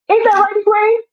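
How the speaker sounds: high, sing-song cooing voice; mock sugary captor. Captor cooing at Egwene like a pet, high and sweet. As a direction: coo at her like a pet owner.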